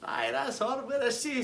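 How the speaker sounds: very high eager caller; Tasty Dave. Retold or imitated. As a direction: ask after her in a high mock voice. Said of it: "And he's like".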